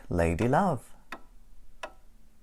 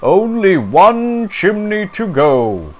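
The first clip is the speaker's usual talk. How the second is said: loud, slow booming Santa voice; 10 semitones higher, 13 dB louder than usual. Acted. Santa telling Stickman one chimney left, booming slowly. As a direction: boom it jolly and slow. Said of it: "till Santa says".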